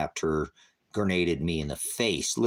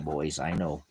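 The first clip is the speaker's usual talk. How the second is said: usual speaking voice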